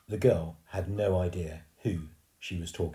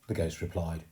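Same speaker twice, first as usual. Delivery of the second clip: ordinary delivery, nothing marked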